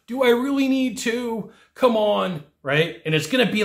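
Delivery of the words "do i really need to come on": high, awkward pleading rep. Retold or imitated. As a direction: squirm through the ask. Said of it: "the most awkward voice".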